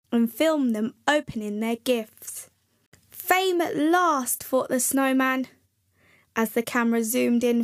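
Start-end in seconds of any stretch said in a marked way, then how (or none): 3.1-4.4 slow, higher, savouring the words; a touch of a star savouring his moment, slowly; grand delight. Acted. Snowman delighted at being filmed.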